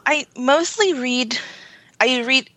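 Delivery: usual speaking voice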